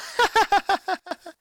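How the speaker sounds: high, loud cackling laugh; gleeful high laugh; laughing at own pun. A narrator's reading. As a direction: crack up at your own pun. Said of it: "Luann laughed at her own pun".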